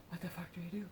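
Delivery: whispered imitation of a silent thought; unspoken shock. Voicing what the woman was thinking, unspoken shock. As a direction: whisper it, jaw dropped. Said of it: "Like, she wasn't talking".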